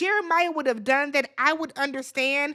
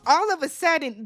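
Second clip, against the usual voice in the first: ordinary talk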